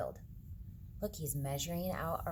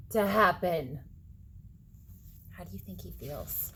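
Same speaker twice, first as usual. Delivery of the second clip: plain reading voice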